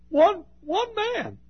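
high, slow, drawn-out preaching cry; full-force cry. Real, not acted. Preacher pleading to the church, slow and high. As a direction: stretch it out as a soaring plea.

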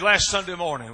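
mock exasperated shout. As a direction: howl it like a worn-out churchgoer. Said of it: "He said".

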